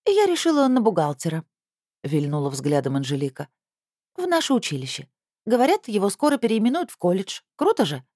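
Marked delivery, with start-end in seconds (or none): 0.1-1.4 put-on young girl, higher and defiant; teen girl voice. Teen girl Anzhelika's lines. 4.2-8.0 put-on young girl, higher and defiant; teen girl voice. Teen girl Anzhelika's lines.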